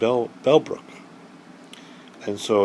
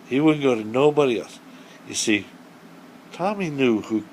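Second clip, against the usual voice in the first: usual speaking voice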